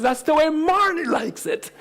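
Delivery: put-on high, matter-of-fact child voice; little girl's voice. Daughter explaining to dad, high and matter-of-fact. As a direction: state it like it settles everything.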